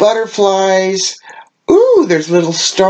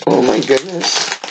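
ordinary delivery

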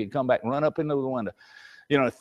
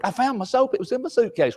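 higher, lightly voiced kid; child; 8 semitones higher than usual. Child tells dad the soap was found, lightly higher. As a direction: report it brightly like a child. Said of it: "they come back".

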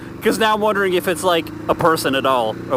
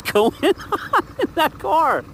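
1 laughing disbelief; 10 semitones higher, much slower than usual. Real, not acted.

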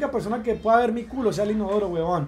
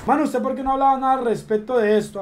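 usual speaking voice

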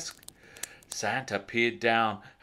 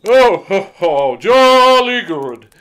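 loud, slow, higher jolly ho ho; chuckling Santa; 7 semitones higher, 18 dB louder than usual. A narrator's reading. Santa pleased with the elf, chuckling loudly. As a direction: chuckle it out as Father Christmas. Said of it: "chuckled Santa".

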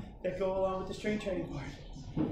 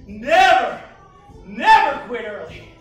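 loud coaching shout; 5 semitones lower, 8 dB louder, slower than usual. Real, not acted. Coach urging never quit.

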